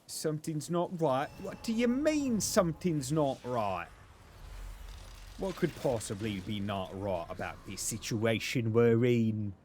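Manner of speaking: hammed-up disbelief in a funny voice; silly put-on voice. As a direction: answer back in a loud, comic character voice. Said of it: "talking like this".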